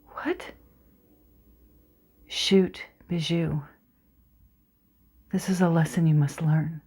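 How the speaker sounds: soft, tense recital; dramatic monologue narration. Acted. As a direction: draw the listener into the dark orchard.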